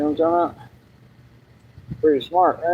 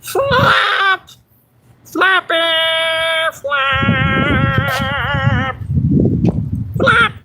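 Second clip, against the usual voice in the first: squeaky voice; 9 semitones higher, much slower than usual. Pretend. Showing off a silly voice, high squeal. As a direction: yelp it like a tiny bird.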